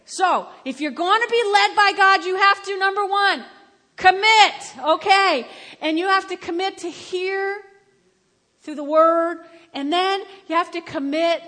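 loud, high, emphatic declaration; full-force preaching. Real, not acted. Preacher vowing to follow one voice, full-force preaching. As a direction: proclaim it to the whole congregation.

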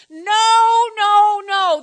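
high, very loud "no, no, no"; emphatic loud refusal. Real, not acted. Pastor overruling the congregation's excuses, emphatic shout. Rebuts excuses he just acted.

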